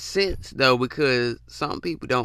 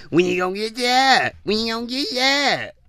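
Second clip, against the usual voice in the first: nagging uncle; 8 semitones higher than usual. Retold or imitated. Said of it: "your uncle always talking about".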